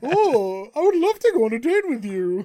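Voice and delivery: loud, high mock gushing; hammed-up smitten date. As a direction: gush it out, over the top and high-pitched.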